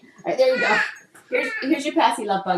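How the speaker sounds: hushed, high soothing. Real, not acted. Settling baby with pacifier.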